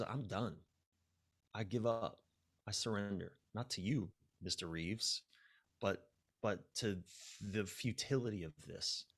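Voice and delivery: soft, resigned giving up. Retold or imitated. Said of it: "her resignation in that moment".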